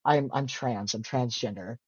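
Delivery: low, soft retold admission; quiet confession. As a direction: let the confession drop away quietly. Retelling coming out to sister.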